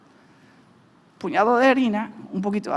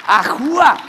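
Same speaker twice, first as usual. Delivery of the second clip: celebratory whoop; 2 semitones higher, 8 dB louder, slower than usual. Real, not acted. Celebrates the green card.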